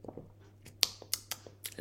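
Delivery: talking right after a sip of tea; words just after a sip; while sipping a drink. Real, not acted. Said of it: "take a quick sip of tea".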